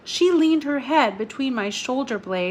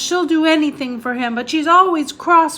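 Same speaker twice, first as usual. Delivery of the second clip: louder and higher, hurt; more loudly; 6 semitones higher, 6 dB louder than usual. Acted. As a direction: raise the voice, close to tears of complaint. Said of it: "Maggie said more loudly".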